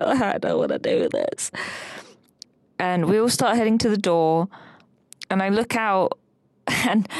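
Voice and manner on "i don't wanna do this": mock whimper. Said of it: "crying even more".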